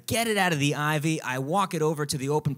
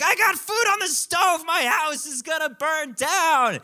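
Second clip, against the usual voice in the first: very high, loud mock panic; imitated freak-out; 12 semitones higher, 5 dB louder than usual. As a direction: panic at high pitch, hammed up for laughs. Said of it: "She starts freaking out".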